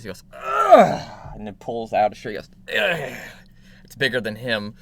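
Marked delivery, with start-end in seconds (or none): 0.3-1.1 loud, high straining dad grunt. Acted. Tailor reaching down for the shirt, loud dad grunt. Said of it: "you hear him do dad grunt noises". 2.8-3.2 higher straining grunt; short effort noise of a dad lifting. Acted. Tailor hauling out a huge shirt, dad grunt. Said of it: "you hear him do dad grunt noises".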